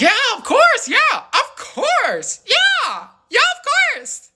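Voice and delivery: high, drawn-out sing-song yeahs; mock eager friend. Telling the story, mimics his friend's airy high agreement. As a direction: ape her breezy eager agreement.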